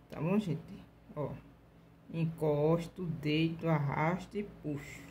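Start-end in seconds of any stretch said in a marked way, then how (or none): none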